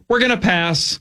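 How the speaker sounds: high dismissive intern brush-off; a hint of a bored intern; snooty intern voice. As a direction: play a bored young intern turning them down. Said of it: "eighteen-year-old intern's like".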